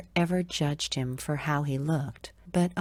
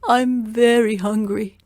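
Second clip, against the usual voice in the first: high pleading voice; poor man begging; 7 semitones higher, 9 dB louder than usual. Acted. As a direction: beg a stranger for help. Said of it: "the man asked Zheng".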